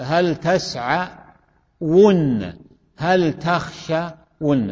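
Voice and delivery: تسعون and تخشون very slow, the doubled nun held; drawn-out demonstration. Shown as a demonstration. Teacher giving class examples, drawn-out demonstration. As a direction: stretch out the endings of تسعون and تخشون.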